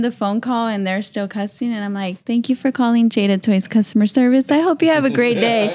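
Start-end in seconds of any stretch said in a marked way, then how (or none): 2.2-5.7 louder, lifted customer-service patter, speeding up; sweetly scripted politeness. Retold or imitated. Agent signing off to cussing customers, pointedly cheerful. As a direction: rattle off a cheery sign-off.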